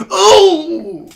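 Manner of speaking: loud high-pitched "oh" of disgust; cringing yell. Retold or imitated. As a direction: re-enact a cringe yell in a cinema. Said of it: "I actually yelled in the theater".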